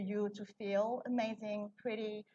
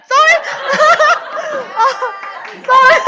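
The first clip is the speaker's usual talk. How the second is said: embarrassed laughing; 23 dB louder, faster than usual. Real, not acted. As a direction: burst of high loud giggles. Apologizes after swearing on camera.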